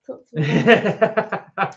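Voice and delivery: slow, laughing mock of a smooth-talking gym guy; "Talk to me nice" drawn out slowly, laughing, as a posing gym guy; mock Bay Area guy. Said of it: "Is that how they talk?".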